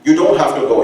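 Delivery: loud, high, fast demanding father; indignant grandfather. Retold or imitated. Grandfather scolding his son, loud and high. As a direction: push back hard at the son. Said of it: "father voiced".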